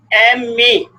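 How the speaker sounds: एमए said letter by letter as em-ay; M.A. spelled out. Shown as a demonstration. Teacher to class, spelling out एमए with a clear em sound. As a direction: sound out एमए slowly, starting with the em sound. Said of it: "अब इसको बोलते हैं एमए".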